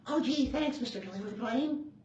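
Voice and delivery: put-on high, eager kid; little boy voice. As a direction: chirp it like a trusting child. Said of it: "Tommy answers the plane".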